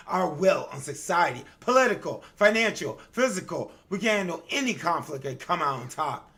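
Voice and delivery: loud, proud listing of powers; arrogant boast. Acted. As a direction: boast each word like a tyrant. Arrogant noble boasting about power.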